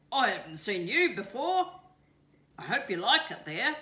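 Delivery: frog voice. A narrator's reading. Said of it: "he croaked".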